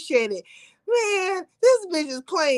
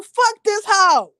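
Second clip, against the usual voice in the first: louder and slow, spat out; loud dismissal; 4 semitones higher, 7 dB louder, slower than usual. Acted. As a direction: slam each word down hard. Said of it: "To say to you".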